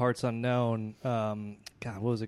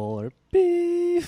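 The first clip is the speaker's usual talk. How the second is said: very high, loud electronic beep; microwave beep imitation; 6 dB louder than usual.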